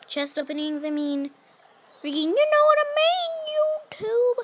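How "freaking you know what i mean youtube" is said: high, loud gamer rage; raging gamer. Real, not acted. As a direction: vent at YouTube with a yell. Raging to viewers.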